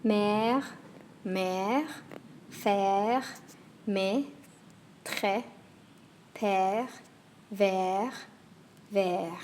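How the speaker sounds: "Mère", "faire", "père" with a long open è; French è words. Shown as a demonstration. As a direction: let the è ring open. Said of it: "lots of words with that same sound".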